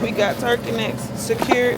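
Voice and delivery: triumphant turkey-neck news; excited announcement. Real, not acted. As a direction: announce the win loudly.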